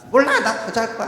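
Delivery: loud, high petulant outburst; defiant refusal. Acted. Daughter refusing to get out of bed, petulant. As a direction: refuse loudly like a cranky child.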